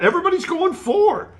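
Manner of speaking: high excited announcing. Real, not acted. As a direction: call it out thrilled. Excited at vote results.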